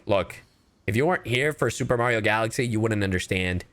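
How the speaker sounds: normal talking voice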